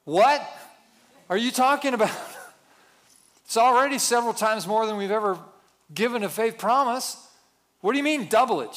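high, loud disbelief; mock outrage at the wife's idea; husband balking at doubling the pledge; incredulous outcry. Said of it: "And she said".

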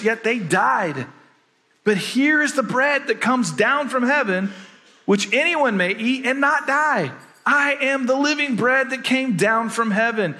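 scripture reading. Acted. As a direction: read Jesus' words with rising fervor.

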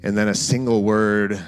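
guided scripture meditation. Acted.

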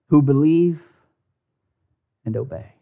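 ordinary talk, nothing marked

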